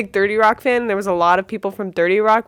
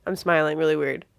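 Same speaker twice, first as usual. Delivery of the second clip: confused mumble; 5 semitones lower, 7 dB quieter than usual. Retold or imitated. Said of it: "confused face where he just sits and he goes".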